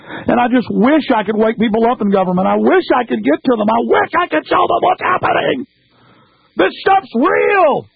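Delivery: plead, voice rising until it nearly breaks on "This stuff's real"; desperate climbing plea; rising despairing plea. Real, not acted. Despairing plea to government people.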